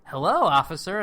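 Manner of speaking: loud, breezy imitated greeting; mock nonchalant. Retelling Bodie blocking an officer, loud mock-nonchalant greeting. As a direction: toss out a casual hello, hammed up. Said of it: "nonchalantly, he goes".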